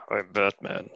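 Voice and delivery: slow, hushed superhero impression; Batman. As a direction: keep it soft and drawn out, like a masked hero. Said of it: "picture him as Batman".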